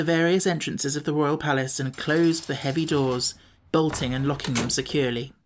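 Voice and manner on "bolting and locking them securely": plain reading voice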